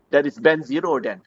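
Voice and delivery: ordinary talk, nothing marked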